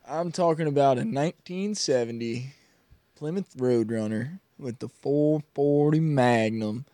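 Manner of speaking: lightly hammed Southern car-guy accent; mock Southern accent. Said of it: "why we all have accents now".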